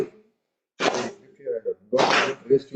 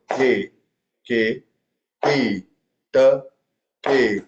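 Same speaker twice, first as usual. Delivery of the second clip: ordinary talk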